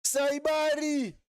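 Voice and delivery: high-pitched, drawn-out cry of GOAL; excited goal call. Real, not acted. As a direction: build from the cross to a soaring, long-held goal call. Live call as a goal is scored.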